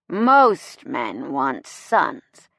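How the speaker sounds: very slow, low-pitched boozy pronouncement; sloshed speechmaker holding forth; drunken. A narrator's reading. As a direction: drag each word out, tipsily. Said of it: "Jameson drunkenly informs the crowd".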